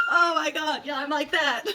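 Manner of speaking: high-pitched fangirl freak-out. Retold or imitated. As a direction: gush it, pitch jumping up. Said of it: "like, freaking out".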